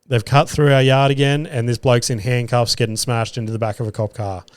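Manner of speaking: ordinary talk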